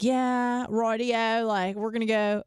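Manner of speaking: imitating the Australian husband's casual plans in a clumsy Australian accent; bad Australian accent. Telling friends what the Australian husband said, in a weak Australian accent. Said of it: "I can't do the accent well".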